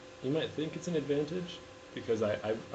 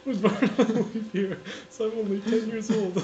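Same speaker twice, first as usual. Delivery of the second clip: loud, high wailed complaint; mock crying; 8 semitones higher, 6 dB louder than usual. Man explaining his leap-year birthday, loud mock crying. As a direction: bawl it out like an overgrown child. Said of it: "Why are you crying?".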